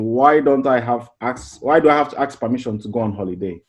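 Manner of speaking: ordinary delivery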